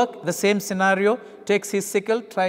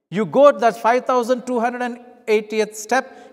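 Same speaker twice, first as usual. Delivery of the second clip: louder and higher, counting off the last step in resentment; angry; 5 semitones higher, 5 dB louder than usual. Acted. First miler finishing the forced mile full of anger, loud and raised. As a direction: spit out the final step. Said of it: "so much of anger and frustration".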